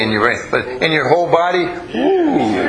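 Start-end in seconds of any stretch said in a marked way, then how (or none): 2.0-2.7 high, drawn-out "ooh"; high-pitched cooing exclamation; impressed ooh. Real, not acted. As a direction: let out a high playful ooh. Reacting to students' whole-body answer.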